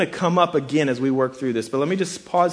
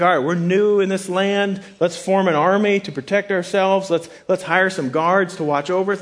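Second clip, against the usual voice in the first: higher, louder voice of eager planners; mock planning; 6 semitones higher, 4 dB louder than usual. Returnees proposing to guard themselves in the new land, mock planning. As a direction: pitch it up like rallying the group. Acts out returnees' supposed plan.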